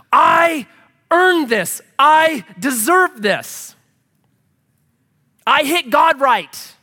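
high, loud, slow entitled shout; mock entitled shout, high and loud; entitled outburst. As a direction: demand it loudly and slowly. Acted entitled inner voice.

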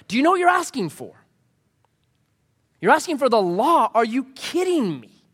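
high, fast, incredulous preaching; voicing Paul, exasperated; impassioned Paul. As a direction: demand it like an outraged apostle. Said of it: "Paul's like".

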